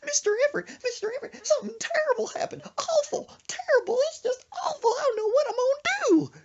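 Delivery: very high, loud hysterical wail; hammed-up panicked falsetto, close to tears; Gomer Pyle. Panicked friend tells dad of disaster, shrieky wailing imitation. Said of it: "talking like Gomer Pyle".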